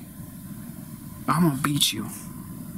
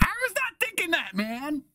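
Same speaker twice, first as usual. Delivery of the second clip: very high-pitched, strained denial; indignant protest; 12 semitones higher, 4 dB quieter than usual. Acted. Man accused by his friend, protesting shrilly. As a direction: protest with the voice leaping way up.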